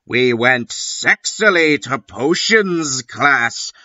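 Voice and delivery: ordinary talk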